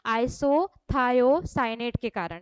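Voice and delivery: isothiocyanate drawn out slowly; slow emphatic answer. Shown as a demonstration. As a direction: give the answer clearly and slowly. Teacher answers own quiz question.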